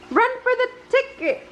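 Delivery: high, urgent cry of alarm; a mother calling her child to flee. A narrator's reading. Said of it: "cried his mother".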